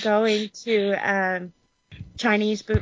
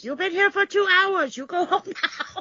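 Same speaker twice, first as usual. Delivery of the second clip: higher mock therapist, gently shooing a client; 10 semitones higher than usual. Retelling her therapist's words in a higher mock voice. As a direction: play the therapist ending an overlong session. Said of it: "my therapist is like".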